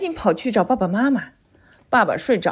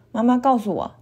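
ordinary delivery

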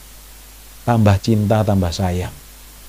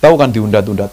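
normal talking voice

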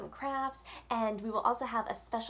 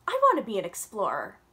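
much louder, quick and thrilled; eager declaration; 9 dB louder than usual. Acted. Yasmin telling her father she wants to explore, loud and eager. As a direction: announce your dream with a burst of excitement. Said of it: "she said".

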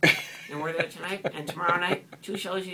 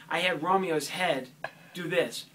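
ordinary delivery

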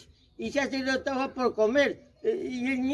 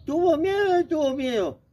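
ordinary delivery, nothing marked

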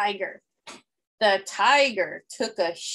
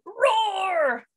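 a picture-book tiger roar; 9 semitones higher than usual. A narrator's reading. Tiger roars wildly.

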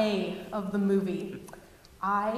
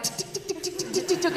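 ticking noise; 7 semitones higher than usual. Retold or imitated. Imitating bags sliding along.

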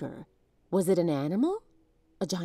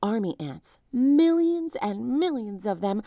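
usual speaking voice

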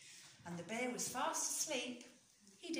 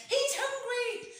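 high, loud, slow frightened shout; urgent shout; 13 semitones higher, 9 dB louder than usual. A narrator's reading. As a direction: cry out in alarm. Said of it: "shouted the squirrel and the fox and the badger and the hare".

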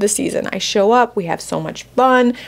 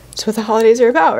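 higher, quick, fighting back real tears; getting emotional; 4 semitones higher, 4 dB louder, much faster than usual. Real, not acted. Mother explaining what holidays mean, getting emotional. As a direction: push through the welling emotion. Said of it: "I'm gonna get emotional".

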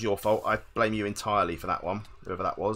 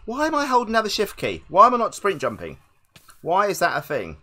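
high, loud, exasperated complaint; raised voice of gamer frustration; exasperated questioning; 7 semitones higher, 6 dB louder than usual. Real, not acted. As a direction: demand to know why it won't work. Frustrated at own failed controls.

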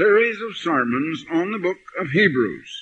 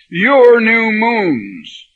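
"Your" hit hard, higher, louder and very slow; stress on your; 6 semitones higher, 7 dB louder, much slower than usual. Retold or imitated. Preacher reading Isaiah to Sardis ministers, hammering "Your". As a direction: lean the whole weight of the verse on "Your". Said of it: "I was emphasizing the word your".

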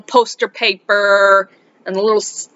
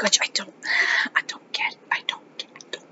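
breathless whisper of disbelief; whispered aside; 9 dB quieter, faster than usual. Real, not acted. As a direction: whisper it to yourself, baffled. Muttering disbelief to herself.

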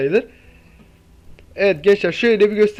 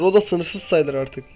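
usual speaking voice

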